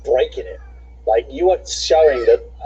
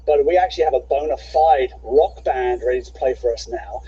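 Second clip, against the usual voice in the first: ordinary delivery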